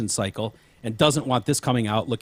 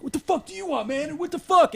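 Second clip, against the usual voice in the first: high, heated shout, much higher pitched; a worked-up guy yelling at the cop; aggressive yell. Retold or imitated. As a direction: confront him, get in his face. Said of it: "and he's like".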